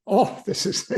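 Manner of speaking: breaking into laughter mid-thought; high, giggly delight at the memory; laughing. Real, not acted. Guest laughing as he tells host about his discovery.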